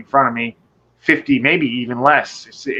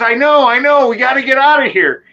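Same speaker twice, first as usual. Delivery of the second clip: much higher and louder frantic pleading; frantic panic; 12 semitones higher, 4 dB louder than usual. Retold or imitated. Cousin urging the speaker to leave the woods, frantic and high. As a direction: beg to get out of there, panicked.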